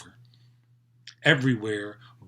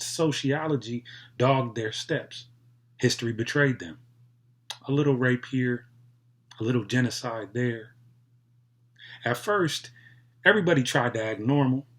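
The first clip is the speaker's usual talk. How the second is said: ordinary delivery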